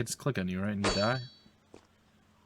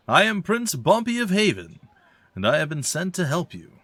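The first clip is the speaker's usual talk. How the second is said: louder and higher, proudly announcing himself; a prince announcing himself, louder; grand royal declaration; 7 semitones higher, 4 dB louder than usual. Acted. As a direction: proclaim your title like royalty.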